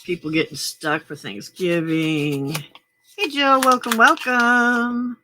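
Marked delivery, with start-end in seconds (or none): 3.2-5.2 high, loud cheery welcome; excited greeting. Real, not acted. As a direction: greet a friend happily. Greets a viewer in chat.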